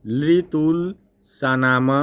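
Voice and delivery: लितुल सानाम said syllable by syllable, very slowly; slow spelled-out recitation. Shown as a demonstration. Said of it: "याद करिए". (धीरे-धीरे अक्षर-अक्षर)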